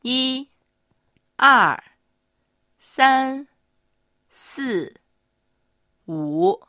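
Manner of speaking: 一 and 三 high level, 二 and 四 falling, 五 dipping; exaggerated Mandarin tones. Shown as a demonstration. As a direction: count 一 to 五 word by word, tones clearly drawn. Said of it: "高いか低いかを意識してみてください". (声調をはっきりつけて数える)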